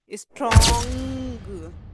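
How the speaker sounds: स्ट्रॉन्ग loud, slow, with stretched 'ong'; NG sound. Shown as a demonstration. Teacher giving NG example, drawn-out स्ट्रॉन्ग. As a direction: draw out the nasal end of स्ट्रॉन्ग. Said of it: "makes the sound of ung".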